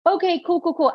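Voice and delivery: high, breezy cool cool; naive nonchalance. Retold or imitated. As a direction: brush it off, unaware. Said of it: "I was like".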